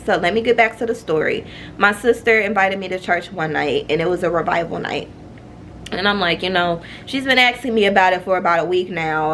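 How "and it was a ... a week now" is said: usual speaking voice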